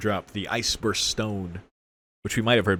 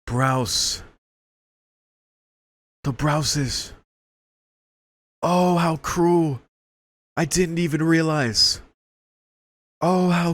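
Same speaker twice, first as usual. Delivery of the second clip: mock dramatic dismay; 9 semitones higher, 3 dB louder, slower than usual. Mocking the Browses' cruelty while watching.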